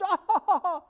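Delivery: high, scoffing laugh of the parodied official; mock laugh.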